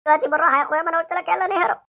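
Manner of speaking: ordinary delivery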